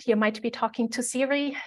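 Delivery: ordinary delivery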